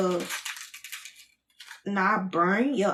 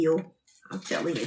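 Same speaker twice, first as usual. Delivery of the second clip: ordinary delivery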